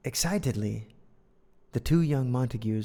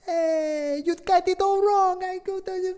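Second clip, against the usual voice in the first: high, hammed-up Italian accent, slowing on the last lines; cartoon Italian, high and bouncy; 7 dB louder than usual. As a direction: play Benvolio with a big put-on Italian accent. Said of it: "Italian accents at that".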